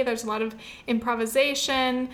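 normal talking voice

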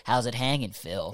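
low, sinking taunt in a doll's voice; Chucky's cheesy line. Retold or imitated. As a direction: drop it low and smug like Chucky. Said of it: "Say it".

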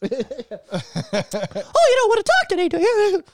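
high, slow, menacing cartoon mouse; Mickey Mouse voice. Mickey Mouse threatening the podcast hosts, squeaky menace. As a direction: taunt them sweetly like an evil cartoon mouse. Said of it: "Mickey Mouse".

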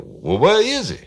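brash caller. Retold or imitated. Prank caller demanding to know where Johnson is, loud. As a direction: push it out like a pushy caller. Retold phone call, male caller.

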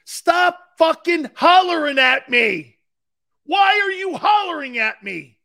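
high, loud, slow furious yelling back; angry outburst. Retold or imitated. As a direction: snap back at the coach, full force. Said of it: "I just stood up and I went".

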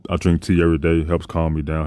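normal talking voice

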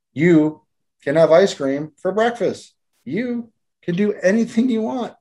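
put-on high, delighted announcement to a child; excited promise. Reads village's promises to children.

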